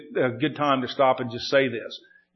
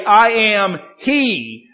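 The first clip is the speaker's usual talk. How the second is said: "I am he" drawn out slow and higher; dramatic, emphatic statement; 5 semitones higher, 5 dB louder, much slower than usual. Acted. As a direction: give each word of "I am he" full weight. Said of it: "dramatic and emphatic statement".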